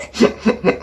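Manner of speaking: quick run of voiceless hiccup noises; involuntary little stuttered hiccups; soft, repeated hiccup tic. Real, not acted. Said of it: "you know the hiccup noise I make".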